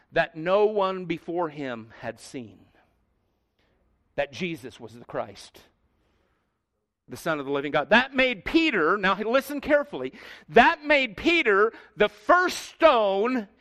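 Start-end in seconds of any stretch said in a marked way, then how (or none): 0.1-7.9 low, soft preacher's hush; reverent hush. Real, not acted. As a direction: sink low and quiet, with pauses to let it settle.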